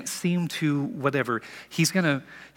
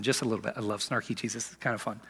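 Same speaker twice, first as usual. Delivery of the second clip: soft aside; 5 semitones lower, 7 dB quieter, faster than usual. Real, not acted. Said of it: "snarky Jesus comes in".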